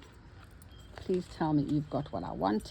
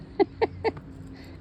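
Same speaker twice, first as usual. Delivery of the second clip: giggle. Real, not acted. Laughs at her own excitement.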